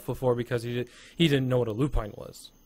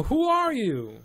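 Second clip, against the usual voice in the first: high-pitched challenge to strangers; demanding shout, pitched up high; calling out; 12 semitones higher, 4 dB louder, slower than usual. Acted. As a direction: call out sharply across a distance. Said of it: "call out in Elven".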